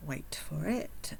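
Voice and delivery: conspiratorial hush before the reveal; whispered aside. Acted. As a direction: lean in and whisper the tease. Sarcastic aside before punchline.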